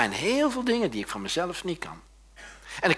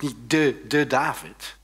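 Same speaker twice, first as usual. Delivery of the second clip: ordinary talk, nothing marked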